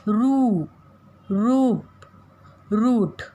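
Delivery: "रू" का ऊ खींचकर ऊंचा; "रूप", "रूट" में लंबा ऊ दिखाएं; long oo demo. Shown as a demonstration. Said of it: "ऊंचा स्वर कहते हैं ऊ की मात्रा के लिए". (बड़ी ऊ का उच्चारण)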